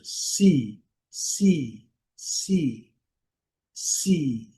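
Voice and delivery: si with a long ee; long English ee. Shown as a demonstration. Teacher modeling si with a drawn-out ee. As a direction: stretch the ee in si. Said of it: "Pouquinho prolongado".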